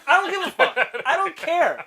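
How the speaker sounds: high, loud fed-up retort; exasperated outburst. Retold or imitated. Answering nosy questioners, high loud exasperation. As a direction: blurt it out, fed up.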